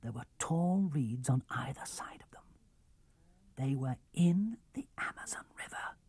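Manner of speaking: whispered, unvoiced narration; hushed storyteller whisper. A narrator's reading. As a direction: whisper it, sneaking up. Stealthy approach, narrator whispers.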